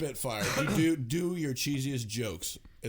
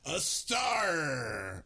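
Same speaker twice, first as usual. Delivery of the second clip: pirate punchline call; 6 semitones higher than usual. Acted. Pirate joke punchline called out.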